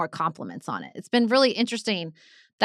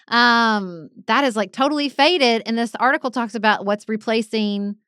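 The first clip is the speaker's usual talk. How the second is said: usual speaking voice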